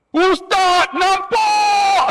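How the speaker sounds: high, loud cry of joy at seeing the Kaabah; pilgrim finally seeing the Kaabah, shouting with joy; excited shout. Retold or imitated.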